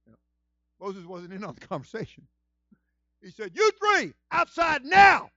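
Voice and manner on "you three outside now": high, loud, slow command; God's shout. Acted. Preacher voicing God ordering them outside, shouting. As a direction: bellow the order like a furious God.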